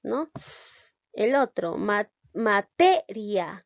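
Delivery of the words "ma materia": "materia" slowed, with TE loud and long; stress on TE. Shown as a demonstration. Teacher showing which syllable sounds stronger, slow stress on TE in "materia". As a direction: drag out and punch TE in "materia". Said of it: "La sílaba T".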